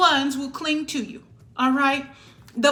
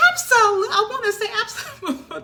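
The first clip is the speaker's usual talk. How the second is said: emphatic absolutely; 10 semitones higher, 4 dB louder, faster than usual. Real, not acted. Emphatic defiant yes.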